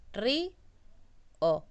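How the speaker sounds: ri, then a pause, then o, each part said apart; split into syllables. Shown as a demonstration. Teacher splitting the word into its syllables, paused. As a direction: break ri and o into two clear beats with a gap. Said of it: "Río se divide en".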